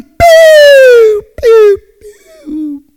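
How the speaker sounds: loud, high falling whoops; high-pitched siren whoop, fading; imitated police siren.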